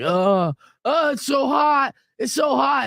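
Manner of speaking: high, drawn-out whine, imitating a miserable partner; imitated tearful complaining; mock whining. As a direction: whine and moan it, hammed up. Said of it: "like, whining and crying".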